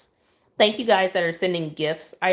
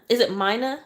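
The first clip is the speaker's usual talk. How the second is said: ordinary delivery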